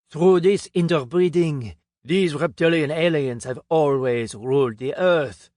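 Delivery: put-on a pompous Frenchman revealing alien bloodlines, French accent. A narrator's reading. French speaker telling the conference crowd, heavy French accent. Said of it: "In his French accent".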